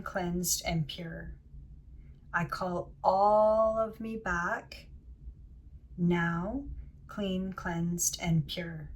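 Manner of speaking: slow chant-like affirmation with long-held words; meditative invocation. Acted. Healer calling all younger selves back, slow meditative invocation. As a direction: draw out ALL, then pause before each phrase.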